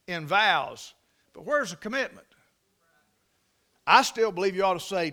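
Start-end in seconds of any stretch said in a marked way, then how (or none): none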